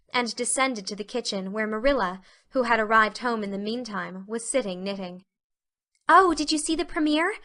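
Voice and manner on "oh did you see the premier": put-on high, girlish burst of curiosity; eager exclamation. A narrator's reading. Anne asking Marilla about the premier, eager exclamation. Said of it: "exclaimed Anne at once".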